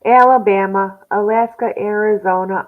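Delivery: ordinary talk, nothing marked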